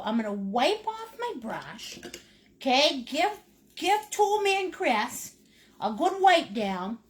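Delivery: ordinary delivery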